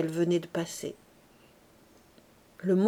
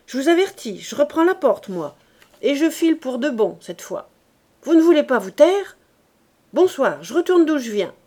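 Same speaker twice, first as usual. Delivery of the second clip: loud, higher angry yelling; furious threat; 5 semitones higher, 8 dB louder than usual. A narrator's reading. Father threatening his family he will leave, loud and furious. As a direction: threaten to walk out for good.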